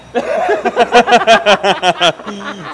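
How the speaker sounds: long high burst of laughter; loud laughter. Real, not acted. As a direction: crack up loudly. Laughing at the impression. (gros éclat de rire)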